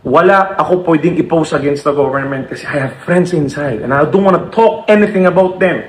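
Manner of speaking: normal talking voice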